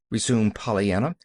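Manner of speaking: ordinary delivery, nothing marked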